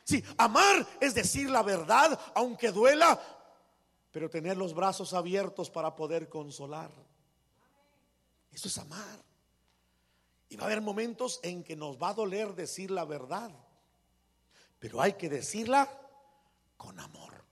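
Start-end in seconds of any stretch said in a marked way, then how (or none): 0.0-3.2 raised, high, urgent plea; impassioned sermon shout; loud preaching. Real, not acted. Preacher scolding with love. 16.8-17.4 breathy whisper of the key words; hushed, tender emphasis. Real, not acted.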